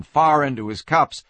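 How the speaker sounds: ordinary delivery, nothing marked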